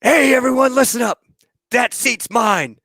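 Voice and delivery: high, hammed-up claiming of the seat; mock loadmaster. Loadmaster hammily claiming the ride seat. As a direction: crow like a greedy kid calling dibs. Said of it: "I remember him going".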